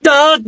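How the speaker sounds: short high cry; anguished cry. Acted. Goddess clutching her temples, crying out. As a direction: cry out as if splitting apart.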